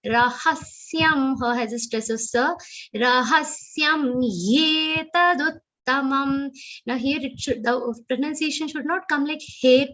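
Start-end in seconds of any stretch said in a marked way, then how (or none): 3.0-6.5 "ye" said whole and clear, not "tat"; full ye. Shown as a demonstration. Teacher correcting Gita recitation, clear full ye. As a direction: chant slowly, giving "ye" its full sound. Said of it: "ye is complete".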